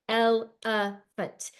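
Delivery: "elephant" said very slowly as el-e-phant; chopped into syllables. Shown as a demonstration. Teacher segmenting a word for kids, slow "elephant" in beats. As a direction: beat out each syllable of "elephant". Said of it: "segmenting these syllables".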